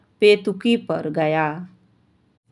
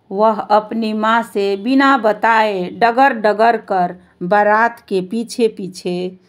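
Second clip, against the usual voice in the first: ordinary delivery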